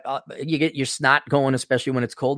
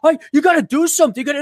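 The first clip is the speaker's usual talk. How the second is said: much higher, fast, frantic begging; mock panicked plea; 12 semitones higher, 3 dB louder, faster than usual. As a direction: whine like a desperate client. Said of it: "he was literally appalled, like".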